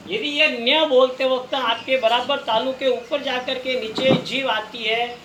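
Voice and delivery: usual speaking voice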